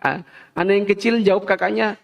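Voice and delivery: ordinary talk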